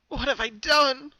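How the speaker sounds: slow, high horrified gasp; pony staring at her bloodied coat, slow horrified gasp. A narrator's reading. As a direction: breathe it out high and slow in shock. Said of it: "she gasped".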